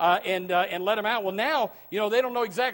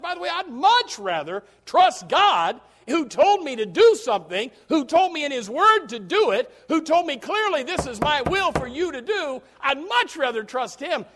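fiery preaching; 8 semitones higher, 7 dB louder than usual. Acted. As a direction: hammer home trusting God. Preaching at full force.

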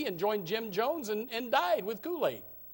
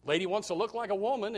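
ordinary delivery

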